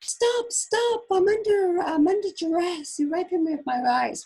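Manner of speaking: high, loud staged cry of distress; mock shout. Prisoner playing up for the camera, mock shouting. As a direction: ham up a protest for the camera. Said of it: "look at the camera and shout".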